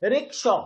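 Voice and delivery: "rickshaw" with the second syllable emphasized; stress on shaw. Shown as a demonstration. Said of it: "shaw is being emphasized here".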